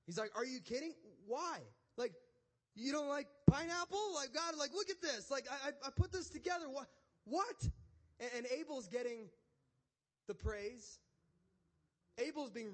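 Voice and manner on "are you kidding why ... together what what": high, loud, indignant protest; angry Cain. Acted. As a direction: act out offended disbelief, voice shooting up. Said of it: "this made Cain angry".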